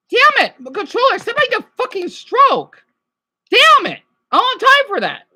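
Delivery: high, loud furious yelling; yelling at controller. Real, not acted. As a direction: scream at the broken controller. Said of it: "I'm being abusive to my controller".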